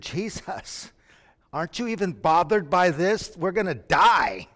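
terrified disciples crying out to Jesus; panicked plea. Acted. Disciples waking Jesus in the storm, panicked plea.

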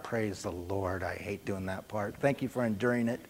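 muttered relief after reading; quiet relieved aside. Real, not acted. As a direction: drop the voice and confess it offhand. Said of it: "I'm gonna break character".

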